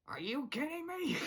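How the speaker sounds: high put-on cartoon voice; Hop Pop. Imitating Hop Pop's reaction to the pet, high put-on accent. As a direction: jump up high into an incredulous character voice. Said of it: "doing the Hop Pop accent".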